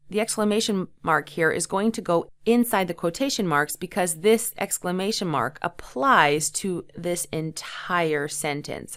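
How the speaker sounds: ordinary talk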